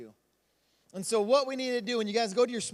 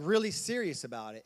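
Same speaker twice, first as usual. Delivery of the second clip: usual speaking voice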